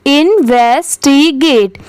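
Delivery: इन्वेस्टिगेट slowly split as इन-वेस-टी-गेट; syllable by syllable. Shown as a demonstration. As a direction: sound out इन्वेस्टिगेट part by part. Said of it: "कुछ part में divide करिए". (इन्वेस्टिगेट को धीरे-धीरे टुकड़ों में बोलें)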